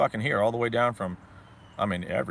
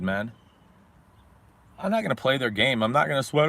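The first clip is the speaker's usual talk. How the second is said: ordinary talk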